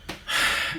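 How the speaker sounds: short, soft sigh; resigned sigh. Real, not acted. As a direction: let out a sigh before the verdict. Sighs before a lukewarm verdict.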